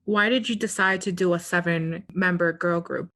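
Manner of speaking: normal talking voice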